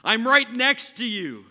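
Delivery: loud high I'm right next to you. Shown as a demonstration. God yelling into the listener's ear, loud and high. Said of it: "I'm gonna whisper".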